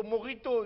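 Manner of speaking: "morito" with a throaty r-like sound for the j; corrected pronunciation of mojito. Shown as a demonstration. Colleague correcting the official's drink name, insistent throaty "morito". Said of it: "on dit".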